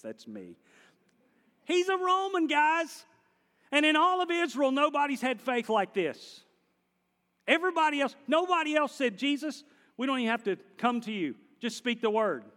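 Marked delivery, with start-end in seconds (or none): 1.6-12.4 high, loud astonished proclamation; Jesus raising his voice. Acted. Preacher voicing Jesus praising the Roman's faith, loud and high. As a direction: let Jesus marvel out loud to everyone. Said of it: "he raised his voice".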